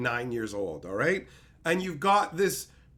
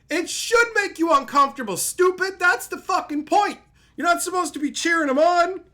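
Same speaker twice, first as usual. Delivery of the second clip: much higher, louder yelling; furious rant; 11 semitones higher, 8 dB louder than usual. Real, not acted. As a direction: shout it at the audience, exasperated. Ranting at fans.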